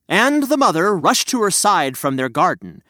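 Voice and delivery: usual speaking voice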